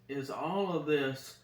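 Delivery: ordinary talk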